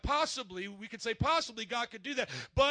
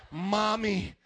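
ordinary talk, nothing marked

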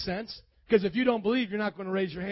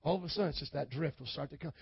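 usual speaking voice

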